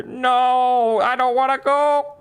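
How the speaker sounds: high, loud mock wail; hammed-up panicked wail. Victim being sent through a portal, hammed-up panicked wail. Acted refusal to enter portal.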